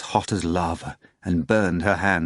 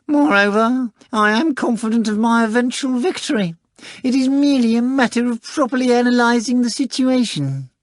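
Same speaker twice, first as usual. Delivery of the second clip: much higher, louder, self-assured cat voice; a smug cat boasting it will win; high showy talking cat, sure of victory; pompous cat; 5 dB louder than usual. Acted. Cat Behemoth's voice.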